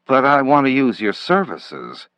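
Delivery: usual speaking voice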